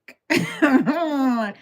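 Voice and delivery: loud high laugh; helpless high burst of laughter. Real, not acted. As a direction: crack up at your own story. Cracks up at own story.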